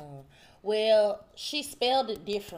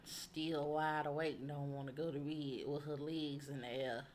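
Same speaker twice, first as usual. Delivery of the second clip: muttering; 4 semitones lower, 7 dB quieter than usual. Real, not acted. Mutters about stubborn child.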